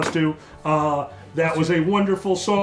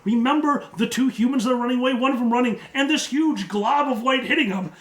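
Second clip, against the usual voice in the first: higher-pitched, building game master narration; excited scene setting; 6 semitones higher than usual. Acted. Game master describes explosions over the battlefield, excited high narration. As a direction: narrate the chaos with mounting excitement and laughter.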